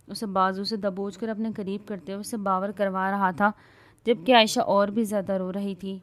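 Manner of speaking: usual speaking voice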